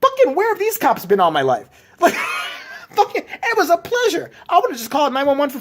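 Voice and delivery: near-shouted glee with a laugh; excited. Real, not acted. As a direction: rave about the cops, laughing. Ecstatic about helpful cops.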